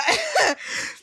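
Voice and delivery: squeaky laugh. Real, not acted. Laugh after cheering.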